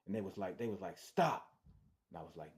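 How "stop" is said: sharp loud command, cops yelling; shouted stop. Retold or imitated. Cop shouting at a fleeing suspect, loud command. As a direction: bark it like a cop ordering a halt.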